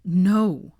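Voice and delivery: refusing comfort, spitting the word; spat. A narrator's reading. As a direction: bite the word off. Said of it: "he spat".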